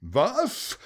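high outraged what; indignant yell. A narrator's reading. Bölker protesting the score, high indignant shout. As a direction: shout it in disbelief. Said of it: "brüllte jemand".

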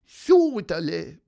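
loud, high, drawn-out squeaky Soderle; bird chirp. Acted. Pieper wraps up the story, drawn-out high chirp. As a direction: chirp it out slowly like a little bird. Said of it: "piepste Pieper".

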